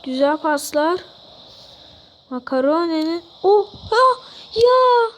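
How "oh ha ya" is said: surprised cry. Real, not acted. Near miss in football game.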